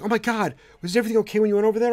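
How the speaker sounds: high, fast mock concern; a hint of an anxious fan; mock worried asker. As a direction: rattle it off in a high fake fret. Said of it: "everyone was like".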